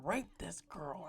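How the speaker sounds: slow whisper of a shocking fact; real whisper, slow; hushed whisper. Real, not acted. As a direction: drop to a whisper, almost afraid to say it. Saying the shocking word softly.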